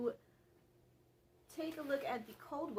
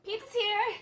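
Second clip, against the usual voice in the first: yelling that the pizza is here; excited shout; 12 semitones higher, 11 dB louder than usual. Real, not acted. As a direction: call it out high and loud. Food delivery arrives, calls out.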